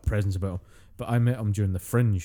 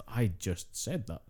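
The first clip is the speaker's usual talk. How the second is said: slow, soft voiced realisation; mock dawning regret; 8 dB quieter, slower than usual. Friend voices his thought on replaying his words, quiet mock regret. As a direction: let it sink in slowly and softly, acting out the thought.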